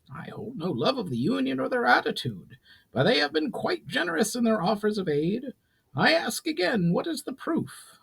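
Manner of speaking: a proud goblin lord laying down terms; goblin chief Brawl. Acted. Brawl presses for proof.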